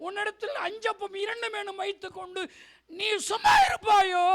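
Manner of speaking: high cry swelling to a shout; impassioned rebuke. Acted. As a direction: challenge them, climaxing in a loud accusing question. Jesus challenges the idle believer. (உயர்ந்த குரலில் கண்டித்து முழங்கி)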